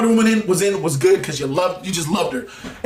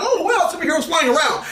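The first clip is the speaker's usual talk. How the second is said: higher, gushing mock wonder; mock awed viewer; 8 semitones higher than usual. Casual viewer amazed at superheroes, mock awe. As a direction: play a wide-eyed popcorn fan. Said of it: "be like".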